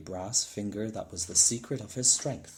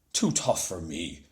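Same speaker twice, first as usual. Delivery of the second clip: lower, louder indignant shout; offended shout; 6 semitones lower, 4 dB louder than usual. A narrator's reading. Giant shouting back at Una, offended. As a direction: throw the question back in outrage. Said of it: "shouted Cuchulainn".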